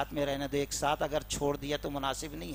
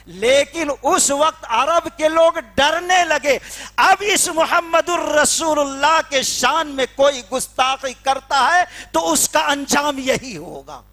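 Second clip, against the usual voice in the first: higher, louder, slow denunciation; preaching at full force; 9 semitones higher, 11 dB louder than usual. Acted. Preacher denouncing the blasphemer to the crowd, thundering. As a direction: thunder it from the pulpit.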